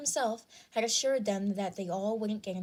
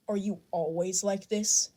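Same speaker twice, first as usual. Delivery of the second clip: sudden interruption. A narrator's reading. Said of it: "All Might interrupted suddenly".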